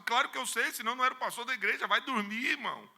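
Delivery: high, loud, fast dismissal; irritated brush-off; annoyed pastor. Retold or imitated. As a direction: wave him off impatiently.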